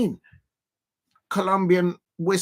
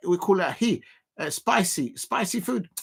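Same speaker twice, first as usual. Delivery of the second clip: ordinary talk, nothing marked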